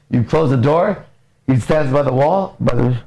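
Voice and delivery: ordinary talk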